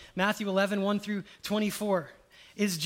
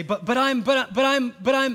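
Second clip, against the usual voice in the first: louder, higher stammering protest; 6 semitones higher, 9 dB louder than usual. Retold or imitated. Believer stammering protests to Jesus, louder. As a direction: keep cutting yourself off.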